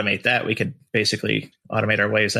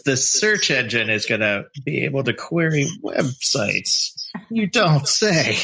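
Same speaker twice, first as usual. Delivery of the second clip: sarcastic; 4 semitones higher, slower than usual. Real, not acted. Mocking obvious feature.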